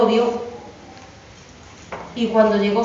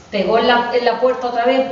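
ordinary delivery, nothing marked

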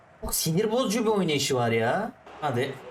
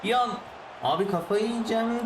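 ordinary delivery

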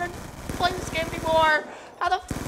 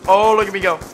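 low, louder mock brag; mock low voice getting louder; 10 semitones lower, 11 dB louder than usual. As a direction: build up the mocking brag. Mock voice, repeated phrase.

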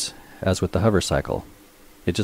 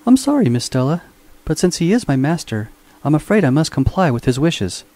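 high, polite robot servant voice; a dutiful mechanical butler declining with regret; prim robot voice; 9 semitones higher, 3 dB louder than usual. A narrator's reading. Robot Minx politely declines Stella, high and prim.

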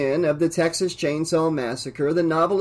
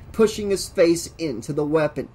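usual speaking voice

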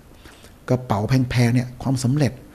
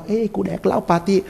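higher, brash partying friend bragging; mock party boaster; 8 semitones higher than usual. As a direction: ham up a friend showing off his night out. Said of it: "ทุกคนถ่ายรูปหมดว่า".

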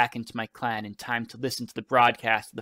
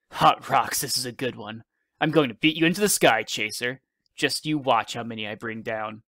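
usual speaking voice

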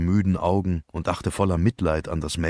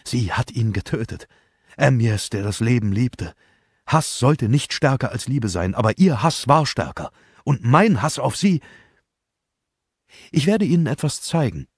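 higher and louder, full of hate; passionate hatred; 5 semitones higher, 4 dB louder than usual. Acted. Elsa tells Poirot of her hatred for Caroline, passionately. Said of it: "ihre Brust hob und senkte sich leidenschaftlich".

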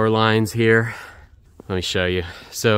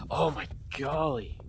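excited exclamation; 4 semitones higher, 8 dB quieter than usual. Real, not acted. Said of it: "phrase that people say when they're excited".

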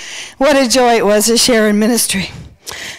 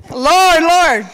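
higher, helpless wail; mock panic; 4 semitones higher than usual. Speaker facing the kitchen fire, mock panicked cry.

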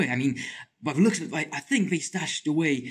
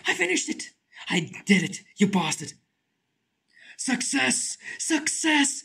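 excited triumph; 9 semitones higher than usual. Real, not acted. Completes puzzle.

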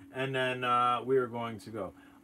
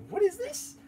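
surprised question. Retold or imitated. Discovering drone videos.

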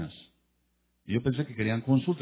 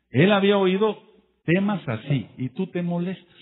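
loud, high rebuke to the congregation; fiery preaching; 8 semitones higher, 7 dB louder than usual. Acted. Preacher scolds the offended listener, loud and high.

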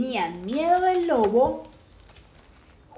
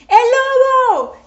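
high, loud cry of alarm; full-voiced boy's alarm; shout; 14 dB louder, faster than usual. A narrator's reading. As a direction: yell desperately for attention. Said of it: "gritaba Juan".

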